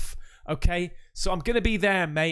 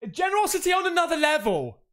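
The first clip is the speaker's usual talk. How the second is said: excited; 9 semitones higher, 3 dB louder, faster than usual. Real, not acted. Gushing thanks.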